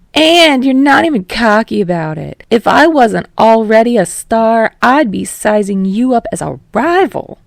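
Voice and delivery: loud, high, cocky banter; brash showman. Acted. Yamada teasing Kirishima about rivalry, loud and cocky. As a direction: tease him like a rock star.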